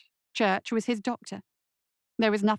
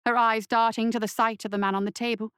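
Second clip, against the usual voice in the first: ordinary delivery, nothing marked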